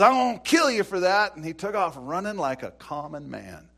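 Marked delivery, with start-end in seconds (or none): 0.0-1.4 loud, high threatening shout; Jezebel's threat. Acted. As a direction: bark the death threat like an enraged queen.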